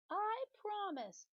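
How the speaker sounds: very high, very slow promise; pigtail voice. Acted. Pigtail promising Paul, very high and drawn out. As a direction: draw out the promise innocently.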